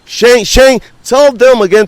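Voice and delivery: loud, high hype call to a guest; wrestling promo. Acted. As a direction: shout it like a ring announcer. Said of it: "let me do my promo now".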